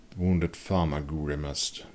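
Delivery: ordinary delivery, nothing marked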